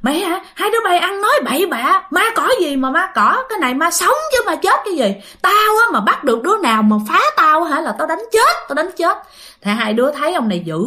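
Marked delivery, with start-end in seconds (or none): 0.0-9.2 higher-pitched imitated tirade; angry cursing neighbor. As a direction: act out an irate man scolding and threatening. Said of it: "ổng nhìn hai đứa mà ổng chửi".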